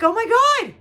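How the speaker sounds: high, loud alarmed cry; retold panic. Mother hears her daughter can't be found, high panicked outburst. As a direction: re-enact a frantic "oh no" reaction. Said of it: "now I'm shaking".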